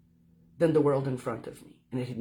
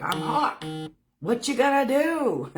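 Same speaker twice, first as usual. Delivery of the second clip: higher, louder swaggering challenge; cocky hawk voice; 8 semitones higher, 4 dB louder than usual. Pretend. Hawk on the fence challenging her, playfully cocky. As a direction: play the hawk daring her. Voicing the hawk's look.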